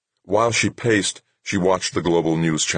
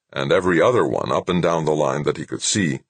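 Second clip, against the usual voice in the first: usual speaking voice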